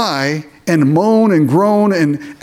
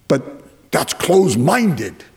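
mocking objector; slower than usual. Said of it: "people say".

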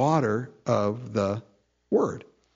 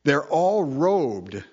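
slow and drawn out; slower than usual. Acted. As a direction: stretch out they're all robed, slowly. Repeated phrase, first one drawn out.

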